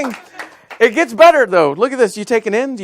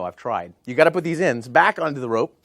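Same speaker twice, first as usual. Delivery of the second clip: normal talking voice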